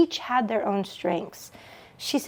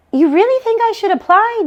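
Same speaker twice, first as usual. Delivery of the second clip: much higher, rising, hopeful question; Joan hopeful; 11 semitones higher, 5 dB louder than usual. Acted. Joan asking her sister if she should apply, hopeful rise. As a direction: let the hope slip through.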